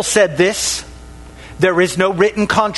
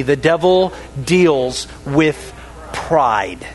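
slow and deliberate, leading the congregation; slow unison repeat; 5 semitones lower, slower than usual. Shown as a demonstration. Preacher leading the church in a slow repeat. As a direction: spell it out slowly so they can say it along. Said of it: "Can you say that sentence with me?".

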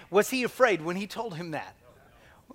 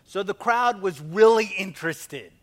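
ordinary delivery

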